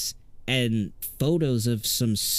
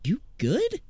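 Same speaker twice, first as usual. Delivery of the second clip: Jack asking. Acted. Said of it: "Jack says".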